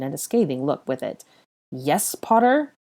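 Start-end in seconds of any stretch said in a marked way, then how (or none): 1.7-2.7 slow, louder, drawn-out irritation; impatient reply. A narrator's reading. Malfoy answering Harry, impatient and drawn out. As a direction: make him feel he is wasting your time. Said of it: "he said impatiently".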